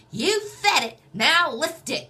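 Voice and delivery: high, loud exercise-class holler; Richard. Retold or imitated. Richard rallying his exercise class, high loud call. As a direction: belt it out high and punchy to the class. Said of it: "And he'd be like".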